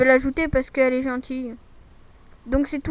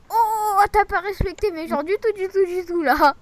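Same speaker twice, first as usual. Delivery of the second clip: high, loud playful scolding; mock outrage; 5 semitones higher, 6 dB louder than usual. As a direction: tell them off in jest.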